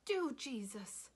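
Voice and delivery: drawn-out high cry to Jesus; high wild scream. A narrator's reading. Willie Mae hearing trouble, high wild scream. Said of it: "she screamed, her voice high and wild".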